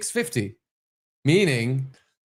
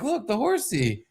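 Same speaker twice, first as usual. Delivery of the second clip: high, slow coaxing sing-out; coaxing parent; 10 semitones higher, 4 dB quieter, slower than usual. Retold or imitated. Parent showing a child the horse, high coaxing voice. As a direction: coax a little one, high and drawn out.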